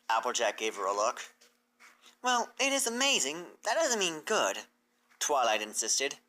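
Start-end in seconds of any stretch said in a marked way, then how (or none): 2.2-4.7 higher, defensive; a mare insisting it's still amazing; Twilight's voice. Acted.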